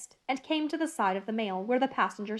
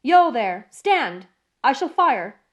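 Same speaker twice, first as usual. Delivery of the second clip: loud shouted warning; roared challenge; 2 semitones higher, 8 dB louder, slower than usual. A narrator's reading. Guard challenging the unseen rider, loud roar. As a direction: bellow a halt into the mist. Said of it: "as loud as he could roar".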